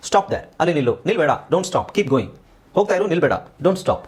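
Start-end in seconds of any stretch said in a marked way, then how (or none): none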